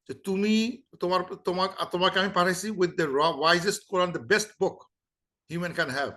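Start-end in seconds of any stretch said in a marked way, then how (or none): none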